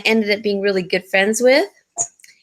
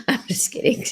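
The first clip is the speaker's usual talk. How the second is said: whispering; 4 semitones higher, 5 dB quieter than usual. Real, not acted. Laughing whispered aside.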